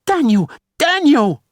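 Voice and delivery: high, loud cry of terror; frightened wife crying out for help; terrified scream. A narrator's reading. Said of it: "Natalie screamed again in terror".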